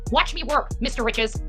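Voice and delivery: ordinary talk, nothing marked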